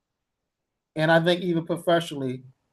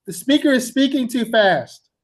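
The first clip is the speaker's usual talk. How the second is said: higher-pitched mock student complaint; complaining student; 7 semitones higher, 3 dB louder than usual. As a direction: imitate a student protesting, pitched up. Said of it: "Sometimes students say".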